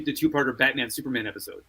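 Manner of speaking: ordinary delivery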